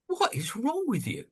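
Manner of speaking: high, soft disbelieving question; mock incredulous crowd. Everyone staring at the contrarian, high mock disbelief. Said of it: "everyone looks at you and goes".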